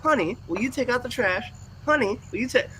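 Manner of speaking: high, insistent repeating wife voice; mock nag, over and over; husband mimics wife pestering about the trash; nagging wife imitation. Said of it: "And she's gonna say".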